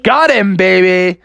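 triumphant victory call; cocky celebration; Venkman. Acted. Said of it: "Venkman said".

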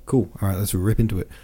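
plain reading voice

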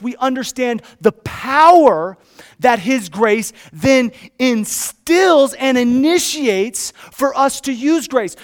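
loud, high-pitched pulpit delivery; full-force preaching. Real, not acted. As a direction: drive each phrase home with punched key words.

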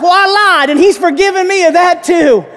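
high, loud, unabashed confession; Delmar. Retold or imitated. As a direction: crow it like a cheerful convert. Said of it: "I think it was Delmar".

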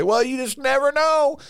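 very high, put-on helpless voice; mocking doubters. Mocks people who doubt the word.

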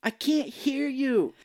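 high, drawn-out yell down the phone; mock yelling to someone who can't hear; imitating a bad phone call. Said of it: "trying to talk to his wife on the phone".

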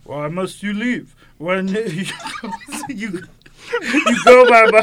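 a hint of a giant's voice, breaking into laughter; Andre the Giant. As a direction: giant voice attempt that collapses into giggles. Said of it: "I'm gonna go full Andre the Giant voice".

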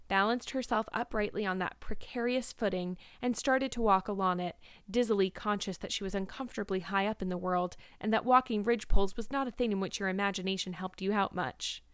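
usual speaking voice

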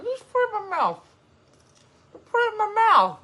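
very high, loud begging voice; pleading squeal. Acted. Begging for a piece of popcorn, high mock plea.

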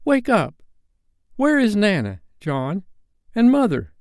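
put-on high, pleading little-boy voice; high-pitched urgent questioning; child's cry. A narrator's reading. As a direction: cry out for the grown-ups. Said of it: "Michael would cry".

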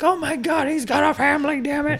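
very high, overwrought wrestling call; Jim Ross meme. Retold or imitated. Mocking old-school wrestling commentary, overwrought. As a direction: cry it out like a melodramatic commentator. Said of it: "like, Jim Ross, like, fucking memes".

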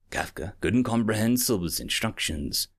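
ordinary delivery, nothing marked

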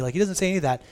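ordinary talk, nothing marked

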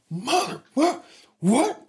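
high disbelieving what what; stunned outrage. Retold or imitated. Bob sputtering as the drunk crew taunts him, high disbelief. Bob hears others going to Hawaii.

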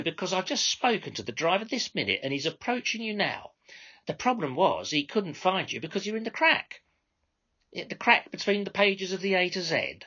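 high, sugary mock politeness; butter him up while mocking him; insincere courtesy. Controller to phone customer, courtesy that amounts to mockery. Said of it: "a tone of courtesy so insincere it amounted to mockery".

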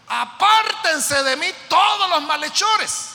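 high, loud, slow rebuke to evildoers; loud preached command to enemies; commanding shout. Acted. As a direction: drive the wrongdoers away. Voicing the psalmist commanding enemies.

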